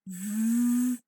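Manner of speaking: long, loud buzzing V; voiced v sound. Shown as a demonstration. Teacher has students check their voice box, voiced V held long. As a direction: hold V with the voice box vibrating. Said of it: "definitely a voiced sound".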